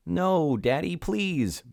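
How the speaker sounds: high, slow pleading; begging. A narrator's reading. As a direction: plead with Daddy, drawn out. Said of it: "begged Betty and Billy".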